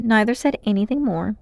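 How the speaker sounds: usual speaking voice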